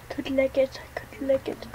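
hushed whisper to himself. Real, not acted. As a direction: whisper so the seeker can't hear. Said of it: "unvoiced whisper while hiding".

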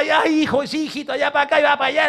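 imitating a busy mom; hurried mother. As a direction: rush through it like a worn-out mother. Voiced tired mother answering.

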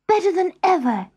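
high, loud burst of excitement; excited polar bear; delighted cry. A narrator's reading. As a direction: exclaim it, thrilled, after a gasp. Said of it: "she cried".

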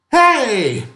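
put-on old man calls out joyfully to the drunk; joyous lilting call. A narrator's reading. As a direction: call out like you just found a treasure. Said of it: "joyous, lilting quality of it".

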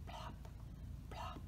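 soft, whispered dropping sound; tiny whispered storybook plop; hushed plop. A narrator's reading. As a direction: let it fall away to a whisper. Narrator imitates dropping apples.

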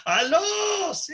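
put-on shouting into an old cell phone; talking super loud. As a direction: yell into a brick phone. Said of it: "uno hablaba súper fuerte".

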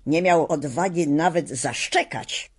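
ordinary delivery

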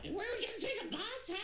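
very high, quick goblin screech; rambunctious goblin. Acted. Goblin asking the boss for orders, rambunctious high screech. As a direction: squeal it fast like a rowdy goblin. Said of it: "he's very loud and rambunctious".

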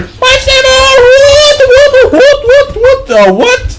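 very high, loud repeated exclamations; bewildered yelping. Real, not acted. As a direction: squeal in panicked confusion. Bewildered in chaotic game fight.

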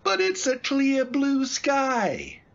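high-pitched, drawn-out protest; incredulous. Acted. Cabbie objecting to passenger's talk of lightning, incredulous. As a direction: push the pitch up in slow disbelief.

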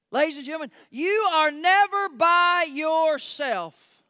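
high, loud, drawn-out proclamation; full-force preaching. Real, not acted. Preacher assuring congregation they are never alone, full-force preaching. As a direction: stretch it out loudly for the congregation.

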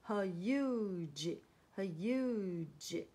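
her huge slowly, split as h-yoo-j; sounded-out long U. Shown as a demonstration. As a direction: stretch the U in huge, separating h and j. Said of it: "让前面的U发U的长音". (huge 拆开慢念，U 发长音)

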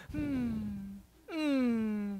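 soft, teary mock hum instead of amen; a quiet tearful hmm; mock crying hum. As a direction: hum it like you're welling up. Imitating women humming amen.

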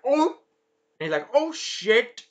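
high-pitched startled yelps; mock alarm. Monster sees the fireball returning, high mock alarm.

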